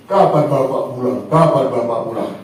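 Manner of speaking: put-on low, longing child's question; a little boy asking for his father, low and wistful; plaintive child. Boy on the beach asking when father returns, low and longing.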